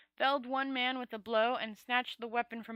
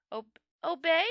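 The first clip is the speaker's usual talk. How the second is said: trembling with terror and delight; 6 semitones higher, 6 dB louder than usual. Acted. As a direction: voice shaking, thrilled and scared. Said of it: "his voice vibrating with terror and delight".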